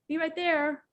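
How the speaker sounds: high, light, friendly sing-song; pleasant reply. Shown as a demonstration. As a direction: answer cheerfully and willingly. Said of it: "Or you can go".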